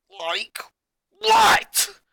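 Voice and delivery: angry. A narrator's reading. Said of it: "he said".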